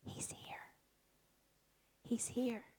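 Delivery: soft unvoiced whisper; hushed holy whisper. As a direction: breathe it close and secret. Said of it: "he whispers and he says".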